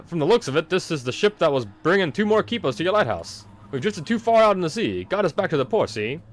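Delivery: high, lilting put-on accent; Captain Drake. Drifting captain asking the lighthouse for help, put-on accent. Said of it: "I don't know why he's that accent now".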